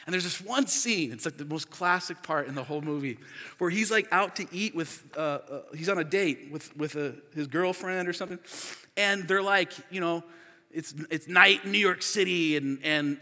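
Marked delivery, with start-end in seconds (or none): none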